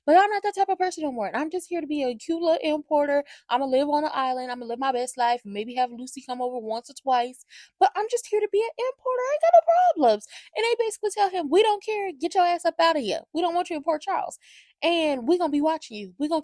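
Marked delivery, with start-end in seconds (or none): 0.1-10.3 high, quick imitation of an innocent act; breezy mock protest. Recapper voices Sidwell pleading he's just an importer, high mock protest. 11.5-16.4 high-pitched, punchy imitation of telling someone off; mock dismissal. Recapper voices the women throwing Sidwell out, high mock dismissal.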